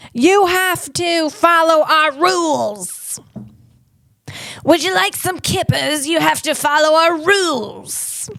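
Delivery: high, slow, hammed-up British accent; mock British accent. British laying down rules to colonists, mock British accent. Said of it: "I can't do a British accent".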